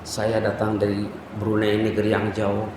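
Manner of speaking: low, soft pleading prayer to God; tearful prayer. Retold or imitated. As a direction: pray it humbly, voice low and soft. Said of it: "Apa doanya?". (doa memohon dengan suara rendah dan lembut)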